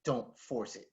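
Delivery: coach's soft aside in the ear; quiet advice. Retold or imitated. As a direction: lean in and say it under your breath. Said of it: "Leans over and he's like".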